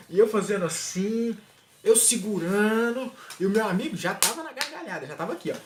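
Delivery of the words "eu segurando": usual speaking voice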